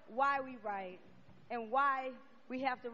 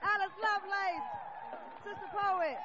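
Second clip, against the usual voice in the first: high, excited emcee calling over applause; announcer sign-off; 8 semitones higher, 4 dB louder than usual. Real, not acted. Host closing the show for audience and band, excited announcer. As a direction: hype the crowd and credit the band.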